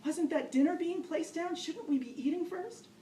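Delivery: anxious beginner dreading the dance floor, panicky and pleading; panicky inner plea. Retold or imitated. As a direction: grasp for any excuse to escape.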